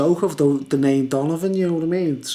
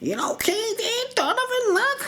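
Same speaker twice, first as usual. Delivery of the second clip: high laughing squeaky mock voice; mocking weird guy. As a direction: squeal the name like a pest. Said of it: "He was going".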